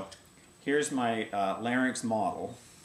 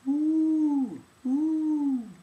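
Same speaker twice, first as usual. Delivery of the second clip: high, loud, dark yawny "ooh"; low larynx yawny ooh; 6 dB louder than usual. Shown as a demonstration. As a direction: drop the larynx and yawn the "ooh". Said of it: "a lower larynx usually sounds more along the lines of".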